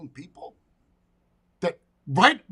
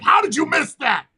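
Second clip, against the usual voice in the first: gamer yelling; 7 semitones higher, 8 dB louder than usual. Retold or imitated. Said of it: "and he's yelling".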